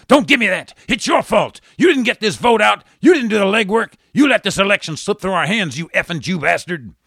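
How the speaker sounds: high, loud furious tirade at the campaign manager; voice rising in fury. Acted. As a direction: blame him, building to a shout. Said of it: "Hillary's voice rising".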